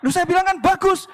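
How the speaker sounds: high, loud burst of approval; pleased surprised reaction. Retold or imitated. Pastor congratulating the wife on the reply, loudly delighted. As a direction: jump in high and loud, missing the problem.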